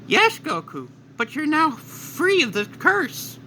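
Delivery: high, slow cartoonish King Kai voice; King Kai talking gently to Goku, high and slow. King Kai reassuring Goku, high and slow.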